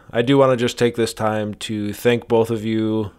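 normal talking voice

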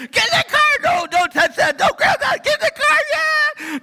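hammed-up stressed parent shouting; frantic parent yelling. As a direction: bark orders herding kids into the car.

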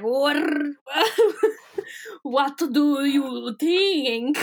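very slow, drawn-out Transylvanian voice; Transylvanian accent. Acted. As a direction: stretch it out like a hammy Transylvanian vampire.

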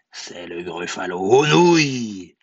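low start swelling on "nouilles"; menacing mouse. Acted. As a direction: draw out "nouilles" as a loud threat. Mouse threatens to eat gruffalo.

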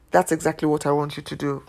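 ordinary delivery

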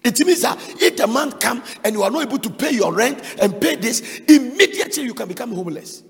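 loud, driving sermon delivery; fiery preaching. Acted. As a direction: hammer the warning home to the congregation. Preaching at full force.

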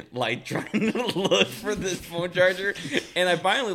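laughing while talking. Real, not acted. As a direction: laugh your way through it. Laughing through story.

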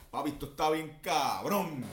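put-on thick Puerto Rican accent, laughing; exaggerated boricua. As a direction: overdo the boricua on purpose. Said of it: "yo exagero mi boricua". (boricua exagerado)

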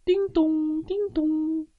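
high, ringing "ding-dong"; doorbell imitation. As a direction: sing out a doorbell chime.

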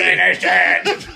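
slow, loud, laughing dramatic line; overacted death scene. Acting Spider-Man movie death scene.

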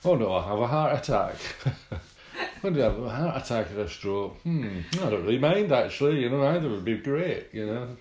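mock breezy indifference. Mocking a reckless drinker to his friend, hammed-up carefree. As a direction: play the addict who doesn't care which kills him. Mocking a careless user's chat.

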